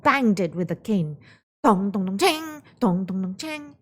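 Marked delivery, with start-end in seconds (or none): 1.6-3.8 pot banging sounds. Acted.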